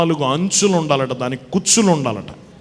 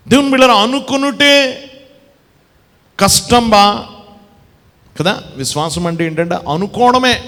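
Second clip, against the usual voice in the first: loud, ringing preacher's call, slowing on the last words; preaching at full force; 9 semitones higher, 6 dB louder than usual. Acted. Preacher calling out loudly to the congregation about the sick woman.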